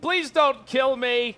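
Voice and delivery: high, loud, drawn-out mock plea; hammed-up begging for mercy; mock pleading. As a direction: play a panicked victim wailing for mercy. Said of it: "Armor Ghost going".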